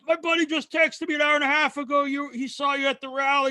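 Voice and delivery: high, eager fan voice; excited drunk fan. Retold or imitated. Drunk fan showing his buddy's text, high and eager. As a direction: rattle it off like a thrilled tipsy fan. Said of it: "he grabs out his phone".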